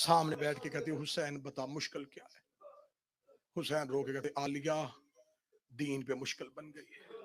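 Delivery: hushed mourning narration. Real, not acted. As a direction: draw them in with a hush. Soft hushed narration of farewell. (دھیمی، غمگین سرگوشی نما آواز)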